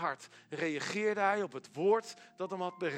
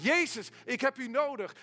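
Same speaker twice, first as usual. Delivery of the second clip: higher, crying out the name Jezus; calling out; 7 semitones higher, 3 dB louder than usual. Shown as a demonstration. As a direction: call out to Jezus with need. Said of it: "En je zegt".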